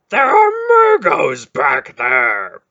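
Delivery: slow, loud protesting shout; loud objection. Acted. Beric objecting loudly to going back. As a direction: drag out the alarmed protest. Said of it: "Beric objected".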